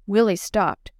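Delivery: ordinary delivery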